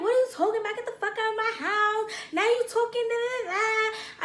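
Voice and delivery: high-pitched retold rant; angry. Woman mad at boyfriend, high angry rant. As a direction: tell him off, trailing into nonsense syllables. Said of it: "like, angry".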